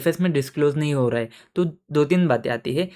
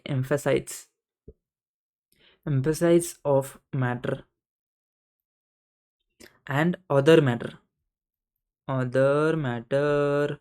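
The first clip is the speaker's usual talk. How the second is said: drawn out, very slow; slow and deliberate; much slower than usual. Shown as a demonstration. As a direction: spell each term out slowly for the class. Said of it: "हम अब इसको बहुत प्यार से बोलेंगे".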